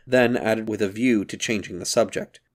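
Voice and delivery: ordinary delivery, nothing marked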